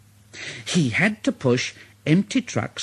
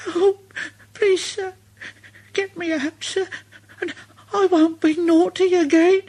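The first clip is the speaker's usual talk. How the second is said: high little engine voice, tearful begging; Thomas pleading. Acted. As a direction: beg the boss to be let off. Thomas begs the fat controller.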